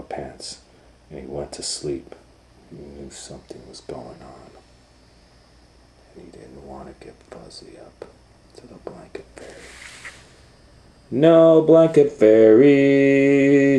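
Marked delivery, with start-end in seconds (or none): 2.7-9.5 hushed storyteller whisper; soft secret whisper. A narrator's reading. Narrator whispers the secret part. 11.1-13.8 slow, high and loud; gleeful little boy; triumphant child. Acted. Owen's triumphant declaration.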